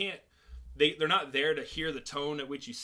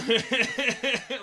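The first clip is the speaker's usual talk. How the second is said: laughs at own joke; 8 semitones higher, 6 dB louder than usual. Real, not acted.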